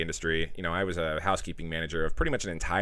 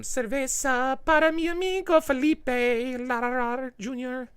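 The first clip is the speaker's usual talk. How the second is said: very high, slow, hammed-up Spanish accent; bad Spanish accent; slower than usual. Streamer toasting donor Felipe in a hammed-up Spanish accent. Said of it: "That was probably the worst Spanish ever".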